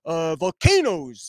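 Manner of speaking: lightly done cartoon bear voice; a hint of a slow, folksy bear; Yogi Bear voice. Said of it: "like, a Yogi Bear voice".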